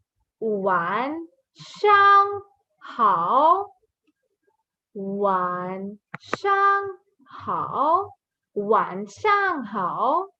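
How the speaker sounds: "晚上好" very slow, each tone stretched out; slow model pronunciation. Shown as a demonstration. Teacher models good evening, slow toned "晚上好". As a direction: draw out each syllable of "晚上好". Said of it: "Then we have".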